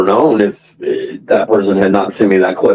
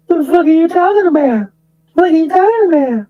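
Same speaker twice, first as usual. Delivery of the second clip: much higher, mocking squeal of Nunya's flustered reply. Streamer mimicking Nunya's rattled denial to Jilly, high mock voice. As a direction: play her rattled and defensive, pitched way up. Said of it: "And I just like".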